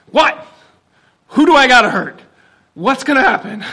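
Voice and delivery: loud, high, panicked father; alarmed. Retold or imitated. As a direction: jump up ready to protect. Alarmed father hearing his child.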